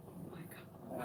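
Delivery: voiceless, hushed mock horror; horrified whisper. Mother hearing son incriminate her, horrified whisper. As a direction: whisper it under your breath.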